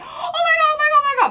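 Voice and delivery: very high, loud, gushing oh my gods; squealing excitement. Retold or imitated. Retelling her reaction to the gold medal, squealing high and loud. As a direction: squeal it like a starstruck fan. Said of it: "I was like".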